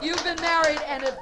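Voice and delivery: usual speaking voice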